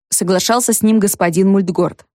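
ordinary delivery, nothing marked

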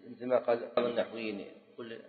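ordinary talk

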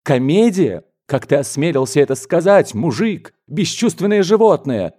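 scandalised outburst; indignant shout. A narrator's reading. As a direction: build from disbelief to a high, angry cry. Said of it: "крикнул дядя".

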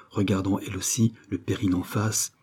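usual speaking voice

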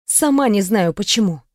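plain reading voice

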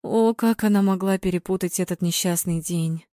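ordinary delivery, nothing marked

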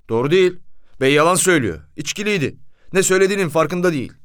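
driver's indignant complaint; insistent protest. A narrator's reading. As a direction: protest loudly against the accusation. Driver protests his accusation is true.

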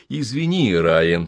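ordinary delivery

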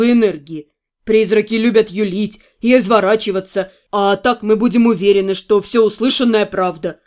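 put-on low, easy young man's voice; a low voice for the fiancé; male lover voice. Male character voice, lower.

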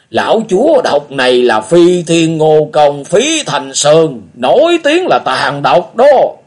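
put-on high, loud, slow old man's voice; a grim warning about a poisoner; old beggar warning.

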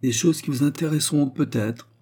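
a hint of a gossip with news to sell; teasing old concierge. A narrator's reading.

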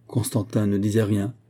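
ordinary delivery

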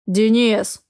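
sharp angry call of Денис. Acted. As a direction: snap the name angrily. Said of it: "Гневно".

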